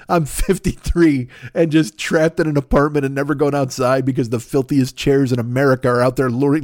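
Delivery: ordinary delivery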